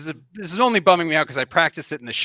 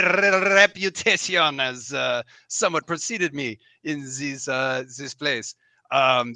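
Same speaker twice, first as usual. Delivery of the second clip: put-on theatrical, courtly accent with a French lilt; Matt Berry; 2 semitones higher than usual. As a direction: gallant mouse knight accent, rolling the R. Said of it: "going for the Matt Berry special".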